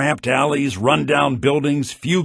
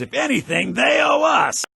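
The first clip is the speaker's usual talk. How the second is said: rising into an angry, much higher shout; bitter outburst; 12 semitones higher than usual. Acted. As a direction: let the resentment burst out. Bitter outburst against Nords.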